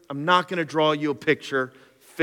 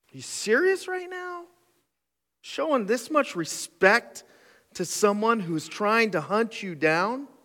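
high-pitched, disbelieving question; incredulous; 6 semitones higher than usual. Acted. Preacher questioning David's respect for his hunter, incredulous. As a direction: ask it high, as if you can't believe it.

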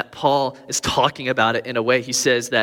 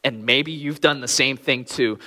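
ordinary talk

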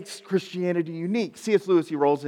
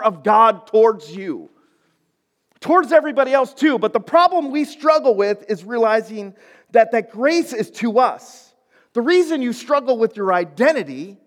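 higher, louder, emphatic sermon delivery; forceful preaching; 7 semitones higher, 7 dB louder than usual. Real, not acted. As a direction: preach it with conviction, stretching key phrases.

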